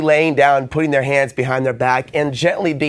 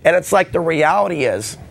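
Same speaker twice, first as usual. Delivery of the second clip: ordinary talk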